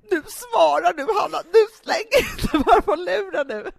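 high, loud mock-mother scolding, laughing through it; imitating scolding mother. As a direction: play Mom telling her daughter off, half laughing. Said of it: "Och sen ringer hon och bara".

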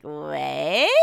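slow, rising, incredulous question; retold disbelief. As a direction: play up your stunned reaction to a price. Retelling her reaction to the price.

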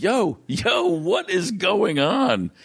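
high, drawn-out, bewildered "what is going on?"; baffled. Retold or imitated. Teller's confused self in story.

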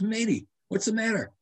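high-pitched imitation of an Australian; Australian accent. Australian passerby checks on a cramped runner, imitated Australian accent. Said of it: "says to me with his Australian, uh, accent".

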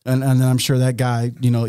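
normal talking voice

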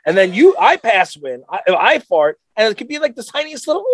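usual speaking voice

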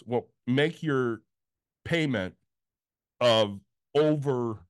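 slow, halting phrases with long pauses; retold agent's drawn-out answer; deliberate explanation. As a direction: spell it out piece by piece, very slowly.

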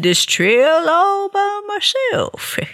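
high, slow and sugary; fake park ranger voice. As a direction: coax them in with a high, slow voice. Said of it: "He'd say, I'm a park ranger".

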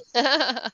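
high, bubbling chuckle; real laugh. Real, not acted. Host teasing caller to buckle up, high laugh. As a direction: laugh at your own teasing.

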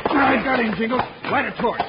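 high loud struggling yell; excited shout. Acted. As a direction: yell while grappling a man. Wrestling the villain in dark.

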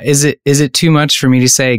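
ordinary talk, nothing marked

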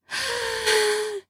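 a high, soft sigh of pleasure. Acted. Woman sighing in her lover's arms, high and soft. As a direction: let out a quiet, pleased sigh. She melts against him.

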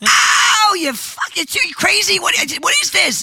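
high, loud pained cry; pained yelp. Retold or imitated. Woman tasting a too-hot spice, crying out in pain. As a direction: yelp like your mouth is burning. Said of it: "and I go".

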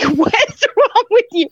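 high, slow, baffled husband voice; husband's baffled voice. Retold or imitated. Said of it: "And he's like".

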